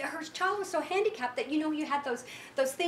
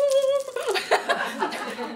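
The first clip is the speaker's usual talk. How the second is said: tearful laugh; 12 semitones higher, 8 dB louder than usual. Real, not acted. Tearful laugh after her story.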